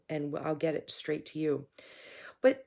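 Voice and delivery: ordinary talk, nothing marked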